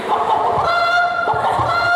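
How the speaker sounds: very high squawking clucks of a hen; clucking hen. Acted. Hen screaming at her hatched chick, shrill clucking. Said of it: "Jenengan mikirnya".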